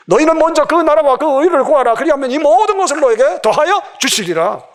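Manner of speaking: high, ringing declamation; scripture proclamation. Acted. Preacher declaring God's promise to the congregation, high-pitched proclamation.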